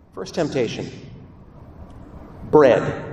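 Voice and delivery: ordinary talk, nothing marked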